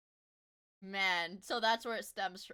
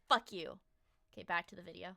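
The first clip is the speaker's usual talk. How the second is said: usual speaking voice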